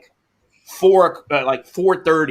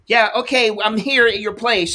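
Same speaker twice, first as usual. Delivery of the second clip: put-on higher, breezy delivery girl; mock driver voice; 6 semitones higher than usual. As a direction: play the driver announcing she's arrived.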